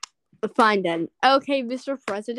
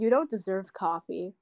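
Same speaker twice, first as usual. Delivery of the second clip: ordinary talk, nothing marked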